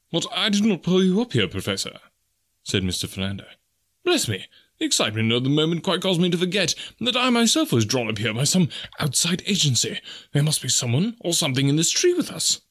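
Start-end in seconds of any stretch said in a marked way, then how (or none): 0.1-2.0 character voice starting high, dropping off at the end; professor's companion. A narrator's reading. Companion correcting the professor, high-pitched surprise. Philander answers in story. 4.0-12.6 high, lively character voice; flustered companion. A narrator's reading. Companion realising someone else is in the tree, flustered.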